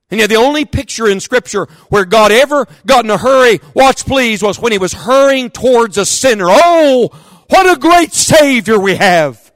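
soaring gospel preaching; preaching at full force. Acted. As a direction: let it swell into a near-song.

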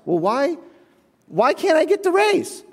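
high-pitched why; mock-wounded plea; pleading disbelief. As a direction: whine the question upward. Arguing with manager over raise.

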